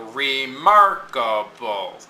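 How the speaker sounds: remarkable slowly, syllable by syllable, MARK stressed; slow remarkable, hitting mark. Shown as a demonstration. Teacher sounding out syllables, slow remarkable with MARK stressed. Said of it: "only one stressed syllable".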